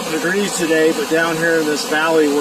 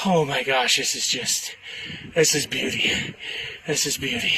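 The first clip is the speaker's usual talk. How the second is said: hushed awe; 6 dB quieter than usual. Real, not acted. Awe at mountain lake view.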